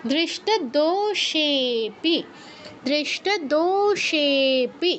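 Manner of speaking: দৃষ্ট দোষেপি very slow with সে stretched longest; slow pluta recitation. Shown as a demonstration. Teacher reciting দৃষ্ট দোষেপি slowly to show the long vowel. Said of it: "সেতে তার থেকেও বেশি সময় ধরে বলতে হবে".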